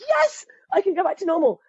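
high, jubilant retold cheer; elated relief. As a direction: cry out yes with huge relief. Retells relief at being done.

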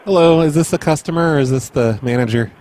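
ordinary talk

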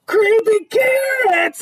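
high, drawn-out scream of alarm; panicked kid crying out; terrified shout. A narrator's reading. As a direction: shriek it slowly in terror. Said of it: "he shouted".

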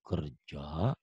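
Kerja said low and soft with a rise; the right way, low and weighty; deep adult voice. Shown as a demonstration. (Kerja diucapkan rendah dan berwibawa)

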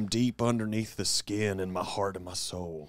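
Southern accent. Shown as a demonstration. Comedian showing who he really is, Southern accent. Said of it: "I'll do this voice".

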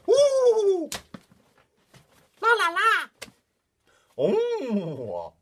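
very high, loud whooping la-las; excited Mister Horse. Pretend. Leader voices excited horse for children, squealing high. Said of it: "when Mister Horse is very excited".

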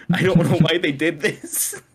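helpless amused bafflement; laughing disbelief. Real, not acted. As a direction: crack up while saying it. Laughing at absurd show writing.

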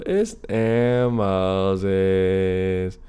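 lazy signature sign-in, very slow; slow drawn-out intro. Shown as a demonstration. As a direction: stretch the name out slowly. Channel intro name drawl.

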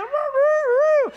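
high, loud, long drawn-out crow; rooster crow. Pretend. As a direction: crow at the top of your voice.

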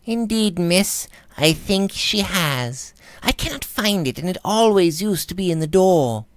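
a deferential maid explaining; timid maid. A narrator's reading. Maid Phoebe answering.

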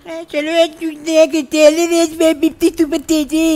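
high mocking girly voice; man doing a woman's voice. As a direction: parrot her in a high mock-girl voice. Mimics her streamer line.

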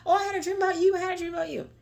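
mocking her old eager prophet voice; overexcited self. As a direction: blurt it like you can't hold it in. Mimics her old excited self.

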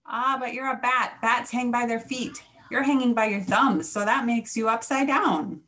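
animated know-it-all creature; insistent creature. A narrator's reading. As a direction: set the youngster straight, loud and lively. Creature scolds Stella Luna, upside down.

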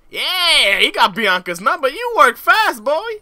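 loud, high hyped-up cheer; excited cheer. Real, not acted. Player cheers his Sim on getting a number, excited. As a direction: whoop it up for your guy.

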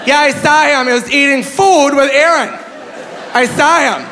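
high, insistent old-lady imitation; protesting old woman. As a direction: plead your case to doubting family. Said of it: "this poor old woman".

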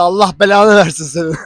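loud, exasperated curse; mock curse. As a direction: play the furious girlfriend, half-joking. Girlfriend cursing at the news.

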